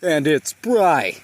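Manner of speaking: loud, slow nature narrator voice; nature documentary. Acted. Parody wildlife narration in bloopers.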